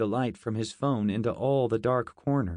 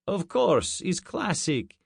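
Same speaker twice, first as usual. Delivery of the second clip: put-on mild Russian accent, higher; light Russian soldier agreeing; Russian accent answering the American commander; 7 semitones higher, slower than usual. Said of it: "This one was Russian".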